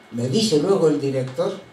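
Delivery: usual speaking voice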